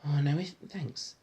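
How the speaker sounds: usual speaking voice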